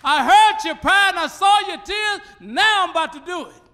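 high, loud shouted declaration; God's shouted promise. Acted. God promising Hezekiah, shouted and high. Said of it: "God said".

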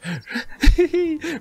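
high-pitched delighted giggle; gleeful giggle. Real, not acted. Player bounces along on a kangaroo, giggling gleefully. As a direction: giggle with glee.

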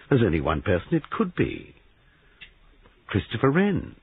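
rising eager, name thrown up high; the penny drops, words tumbling up; excitedly. Acted. Giles to the sergeant, naming a suspect excitedly. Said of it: "Giles spoke excitedly".

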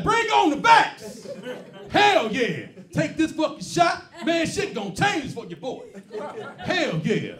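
loud, high, slow hype; eager hype. Retold or imitated. Dude at the vaccine clinic hyping himself up, loud and slow. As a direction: pump yourself up for the shot.

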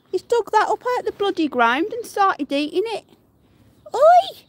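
high, loud outrage ending in a yell; exasperated shouting. Real, not acted. Owner catching pet eating dug-up shell, exasperated shout. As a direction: complain loudly, then shout sharply to stop it.

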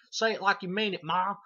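high, loud hollering son voice; hammed-up holler to his mother; Junior. Son Junior eggs on Ma, high loud imitation. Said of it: "her son, fucking Junior, keeps going".

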